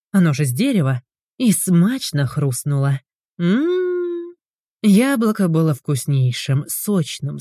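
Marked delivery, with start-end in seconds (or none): 3.4-4.3 high savouring mmm; pleased hum of taste. Acted. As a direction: hum over a tasty bite. Bites juicy apple.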